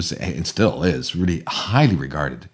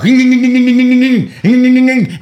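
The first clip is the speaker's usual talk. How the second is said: high, loud imitated starter noise; engine cranking; 12 dB louder than usual. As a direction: mimic a car that won't start. Car engine failing to start.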